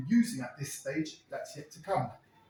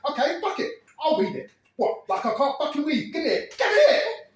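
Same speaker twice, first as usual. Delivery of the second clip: high and loud, snatching; impatient grab; 10 semitones higher, 7 dB louder than usual. Retold or imitated. Terry snatches the letter from Ez, high and loud. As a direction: grab the letter, angry and shrill.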